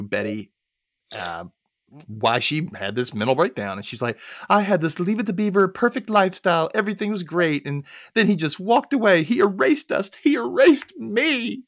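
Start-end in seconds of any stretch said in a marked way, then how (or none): 4.5-11.6 higher-pitched dramatic lament; mock self-pity. Acting Betty's dramatic interview complaint.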